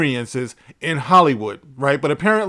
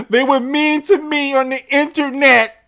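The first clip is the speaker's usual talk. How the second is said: high, whiny mock crying; hammed-up blubbering victim, much higher pitched; mock crying complainer; 10 semitones higher than usual. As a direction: imitate a crybaby's wail. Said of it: "crying, talking about".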